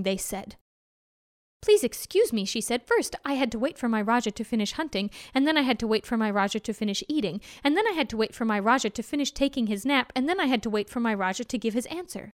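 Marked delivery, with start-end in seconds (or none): 1.6-2.4 lifted, polite little voice; sweet apology, pitched up; a touch of a small mouse. Acted. Mouse apologising to the angry tigers, lightly pitched up. 2.9-12.3 fast, breathless list of excuses; rapid excuses. Acted. Mouse hurriedly excusing herself to the tigers, fast.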